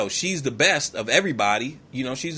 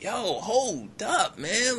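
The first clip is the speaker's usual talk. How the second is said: high, very slow stretched words; acted disbelief, dragging it out high; bewildered hold-up; 8 semitones higher, 4 dB quieter, much slower than usual. Soldier suddenly back at the start, drawn-out bewildered protest.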